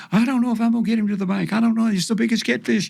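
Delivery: put-on high, fast little-girl panic; panicked little girl. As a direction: squeal it out like a frantic four-year-old. Panicked child fighting big fish.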